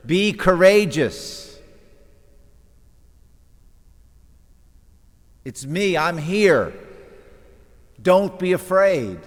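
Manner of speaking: booming slow reassurance over the wind; Jesus calling out. Acted. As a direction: call it over the storm, drawn out. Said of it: "And he said".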